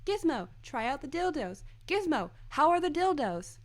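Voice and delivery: high, sing-song calling with falling ends; high imitated voice, each phrase swooping down; Guillermo impression. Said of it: "was Guillermo saying".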